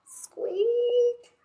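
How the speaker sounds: very high squeak; mouse squeak. Acted. The hidden mouse squeaking at Owl, high and tiny.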